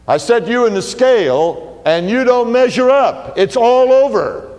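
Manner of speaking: God passing sentence on Belshazzar, thundering; God's verdict. Acted. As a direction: pronounce doom from the heavens, slowing on the last words.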